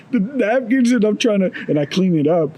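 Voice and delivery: usual speaking voice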